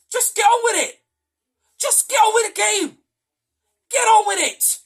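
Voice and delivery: high, loud, fed-up shouting; furious fan yelling at the pitch; exasperated yell. Real, not acted. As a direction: bark it at the player who lost his head.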